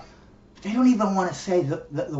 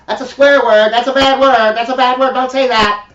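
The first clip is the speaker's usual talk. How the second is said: higher, louder prim scolding; mock scolding prude; 6 semitones higher, 8 dB louder than usual. Mocking the word police, higher and scolding. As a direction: wag a finger at a swearer in mock horror.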